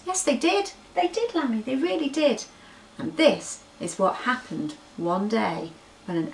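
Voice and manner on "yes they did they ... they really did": high, sing-song reassurance to the lamb; high playful yes they did; playful insisting. Acted. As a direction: lift it up, teasing and sure.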